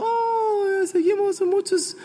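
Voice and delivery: high, hammed-up whimper of a losing fan; mock crying fan. Preacher mimics fans crying over the Warriors loss, mock whimper. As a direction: lament the loss like a teary supporter, half joking. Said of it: "Hay muchos anda llorando".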